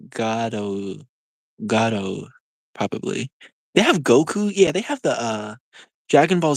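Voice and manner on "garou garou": Garou as "GAH-doh", tapped r; Japanese pronunciation. Shown as a demonstration. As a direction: Garou with an open "ah" and long final "oh". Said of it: "I know the Japanese alphabet".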